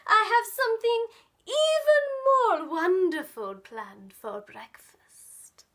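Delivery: starts high and loud, drops to a whisper; scheming fox hinting at breakfast; sly Foxy. Acted. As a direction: let the menace slip into a hush. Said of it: "said Foxy".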